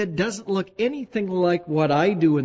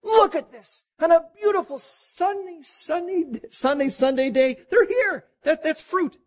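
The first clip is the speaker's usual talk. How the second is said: much higher, beaming proud delight; delighted God; 12 semitones higher than usual. Retold or imitated. God praising his people, beaming with delight. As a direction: show off the fruit with joy. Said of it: "He looks at you and he says".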